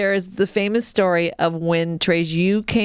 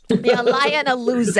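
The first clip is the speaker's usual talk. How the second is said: higher Boston taunt; mock Boston accent; 4 semitones higher than usual. Boston kid calling out her cousin, mock accent. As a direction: echo a Boston kid, Rs dropped.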